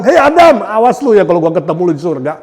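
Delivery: high, loud teasing threat; mock threat to Adam. Believer threatening Adam over sin, loud mock threat. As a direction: warn Adam like a rival, laughing.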